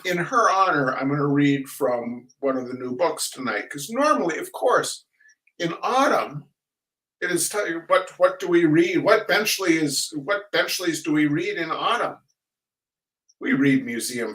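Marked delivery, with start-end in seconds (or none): none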